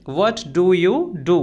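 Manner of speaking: slow, carefully spelled-out English phrase; slow and clear classroom model phrase; slow teaching demo. Shown as a demonstration. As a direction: say each word slowly for the learner. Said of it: "तो हम बोलेंगे".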